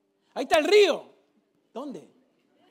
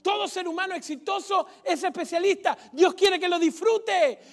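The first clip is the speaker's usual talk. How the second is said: higher, louder sermon voice; fiery preaching; 9 semitones higher, 7 dB louder than usual. Acted. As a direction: preach it to the crowd. Preaching at full force.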